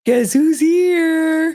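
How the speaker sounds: high, slow teasing announcement; playful host introducing the dog; sing-song announce. Real, not acted. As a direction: draw it out like a reveal.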